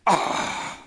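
ordinary talk

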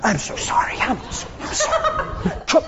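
breathless whisper, giving in; whispered apology. Retold or imitated. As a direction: whisper it, defeated. Parent caves, whispering apology.

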